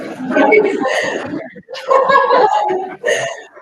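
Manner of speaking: loud, high peals of laughter; real laugh. Real, not acted. Speaker tickled by memory of his singing, loud laugh. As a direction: laugh out loud, helplessly amused.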